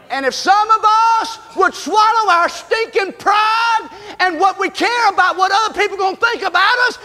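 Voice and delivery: fiery revival preaching; preaching at full force. Acted. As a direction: whoop it up from the pulpit. Preacher builds to full shout.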